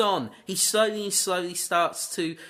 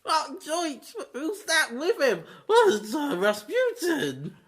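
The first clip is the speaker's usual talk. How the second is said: much higher, cartoonish voice breaking into a laughing cry; cartoon sidekick voice; 10 semitones higher than usual. Answering who is in the family photo, in a high cartoonish squeal. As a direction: squeal it like a startled cartoon side character. Second character joins the skit.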